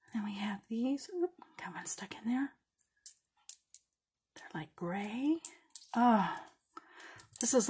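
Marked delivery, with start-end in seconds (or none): 0.1-5.4 hushed, close to the mic; quiet whispered aside; soft whisper. Real, not acted. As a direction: whisper it while sorting beads. Sorting beads quietly.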